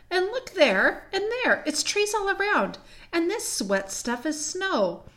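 high-pitched amazed discovery; excited wonder. Acted. Peter discovers trees and snow all around, excited. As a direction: point out each new marvel with wonder.

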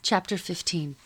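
ordinary delivery, nothing marked

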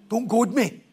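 half-playful warning to back off; mock irritated warning. Mock quarrel, warning someone off.

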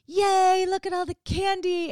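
high, loud squeal of delight; overjoyed kid. Retold or imitated. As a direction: shriek with joy over the candy pile. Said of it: "in this place of".

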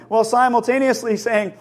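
ordinary delivery

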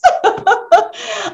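high, breathy giggle. Real, not acted. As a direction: let out a real giggle. Host laughs after the vote.

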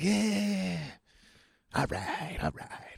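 quiet, breathy brush-off; hushed mock reassurance; dismissive murmur. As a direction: mumble it like you're waving him away. Agents brushing him off quietly.

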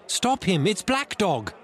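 high, urgent shout; crying out. A narrator's reading. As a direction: raise the alarm across the room. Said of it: "I cried".

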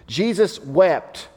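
slow, weighty, each word held; slow solemn repeat. Shown as a demonstration. Preacher repeating the verse slowly to the congregation, solemn. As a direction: let the two words sink in slowly. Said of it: "repeats verse slowly".

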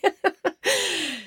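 bright falling laugh; high laugh. Real, not acted. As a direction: let a real laugh out. Laughs at her own story.

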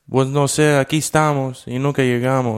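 high, lilting rural Mexican Spanish; sing-song mock Mexican mountain accent; questioning Mexican accent. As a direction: imitate mountain Mexican talk, every phrase like a question. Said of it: "like, in question".

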